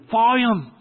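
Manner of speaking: loud, high, urgent holler; retold shout. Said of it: "And he says".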